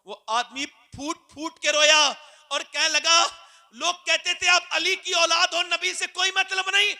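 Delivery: loud, high impassioned preaching; fiery preaching. Acted. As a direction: preach to the crowd at full force, building. Preaching at full force.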